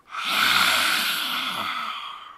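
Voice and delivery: a loud, low, breathy roar through a wide-open mouth; open-mouthed roar breath. Shown as a demonstration. Yoga teacher shows students the big roar, open-throated roaring exhale. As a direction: let the breath out like a roaring animal, jaw wide. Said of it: "let out a bit of a roar with your mouth quite wide open, like this".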